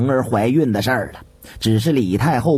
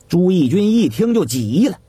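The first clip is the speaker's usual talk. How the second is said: plain reading voice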